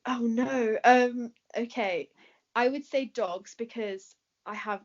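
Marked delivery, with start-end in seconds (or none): none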